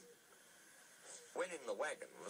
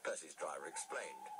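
ordinary delivery, nothing marked

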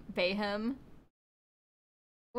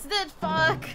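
frustrated outburst; 5 semitones higher, 7 dB louder than usual. Real, not acted. Frustrated slip in game.

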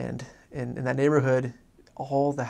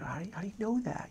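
high, soft, disbelieving question; incredulous companion; 8 semitones higher, 7 dB quieter, faster than usual. Retold or imitated. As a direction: voice climbs high as if he's crazy. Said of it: "with those eyes, like, that I'm crazy".

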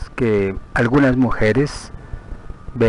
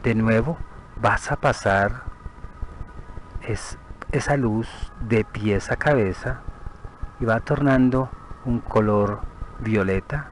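guided meditation; 6 dB quieter than usual. Acted. Guide leading a listener to picture the body from outside, soothing meditation. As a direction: lead them gently through the visualization, unhurried.

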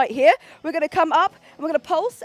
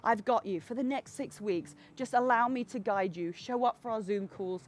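lower, softer encouraging coach; soft reassuring; 5 semitones lower, 7 dB quieter than usual. Real, not acted. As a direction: ease them gently along.

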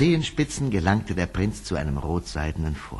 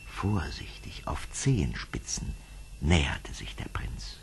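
whispered, softer narration; hushed narration; 5 semitones lower, 7 dB quieter than usual. A narrator's reading. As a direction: whisper so as not to wake her. Prince sneaks toward sleeping girl.

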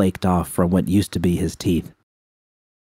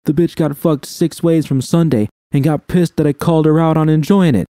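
higher, louder crude jeering; taunting Dom; 9 semitones higher, 4 dB louder than usual. A narrator's reading. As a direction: sneer it out to provoke her.

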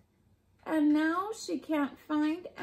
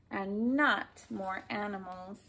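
ordinary delivery, nothing marked

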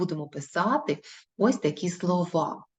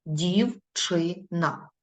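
Дівчина stretched syllable by syllable, very slow; drawn out slowly; much slower than usual. Shown as a demonstration. Teacher checking the exercise with pupils, very slow. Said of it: "Ми підкреслюємо перший склад".

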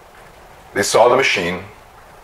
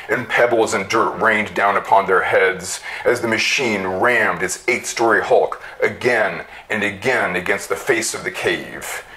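plain reading voice

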